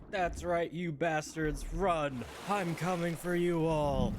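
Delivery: high, loud gloating war cry; triumphant battle cry. Retold or imitated. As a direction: roar it like a victorious warrior. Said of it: "her running out of the exploded wall like".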